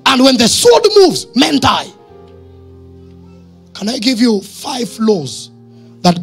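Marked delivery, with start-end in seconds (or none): none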